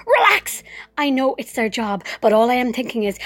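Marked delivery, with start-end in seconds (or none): 0.1-0.6 much higher, louder outraged echo; can't believe she's been told that; incredulous. Acted. Patient repeating the nurses' order in disbelief: outraged echo.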